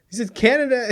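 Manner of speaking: laughing through the words; can't keep a straight face; trying to read the news line but breaking into real laughter; cracking up. Real, not acted. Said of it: "I try to say this with a straight face".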